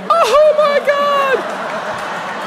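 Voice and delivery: very high, very slow drawn-out oh my God; mock stunned gasp. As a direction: stretch it out in shocked disbelief. His stunned reaction retold.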